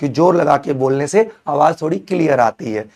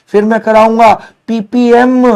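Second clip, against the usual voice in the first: higher and louder, selling the topic like a big reveal; hyped announcement; 6 semitones higher, 6 dB louder than usual. Acted. Teacher hyping his class about the next topic, excited. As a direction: build it up, this one's a showstopper.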